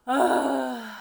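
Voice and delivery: loud grossed-out groan; loud yucky ugh; disgusted ugh. Acted. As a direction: blurt out disgust. Character reacts in picture.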